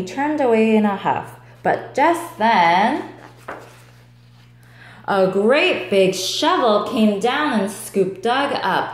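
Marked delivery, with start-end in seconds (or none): none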